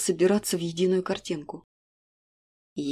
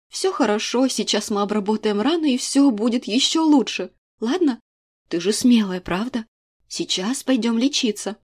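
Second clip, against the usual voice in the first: reassuring; 4 semitones higher, 4 dB louder than usual. A narrator's reading. Mother comforting hurt daughter.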